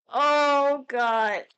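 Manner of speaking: loud drawn-out groan; weary groan. Real, not acted. Streamer who has been live far too long, loud weary groan. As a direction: let out a long "oh God".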